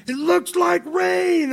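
high, slow, drawn-out retold shout; Jerry screaming out. Jerry on stage calling out to the crowd, high drawn-out shout. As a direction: stretch it out high like calling over a roaring crowd. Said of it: "And Jerry screams out".

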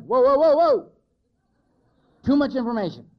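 sudden loud, high "whoa, whoa", backing off; startled whoa. Retold or imitated. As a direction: throw your hands up and cry whoa, overwhelmed. Overwhelmed newcomer cutting off guy.

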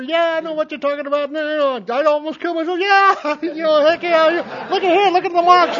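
put-on sarcastic take on the casual old hands; mocking oldtimer voice. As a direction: brush off the newcomer in a cheerful high voice. Mocking the other members.

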